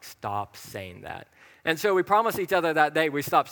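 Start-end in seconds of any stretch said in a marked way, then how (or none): 0.0-1.3 soft, slow, firm hush; God's inner voice rebuking him, soft and slow; gentle rebuke. Retold or imitated. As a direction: settle it quietly and firmly. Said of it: "the Spirit of God was like".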